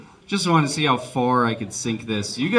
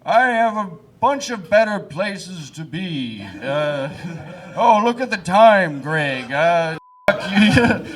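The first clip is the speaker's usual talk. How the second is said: lightly voiced statesman making excuses; a slow, slightly put-on voice begging off; hint of an awkward presidential voice; Abraham Lincoln; 2 semitones higher, slower than usual. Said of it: "Abraham Lincoln would have been all like".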